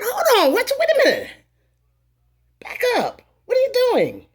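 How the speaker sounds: high, loud, startled protest; alarmed outcry. Retold or imitated. Accused of stealing, she cries out at the employee, high and loud.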